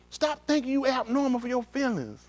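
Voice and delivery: usual speaking voice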